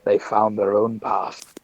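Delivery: slow, low, hushed murmur; low and measured, under the breath; in-character whisper. Acted. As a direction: say it softly as they pass by. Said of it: "you hear me whisper".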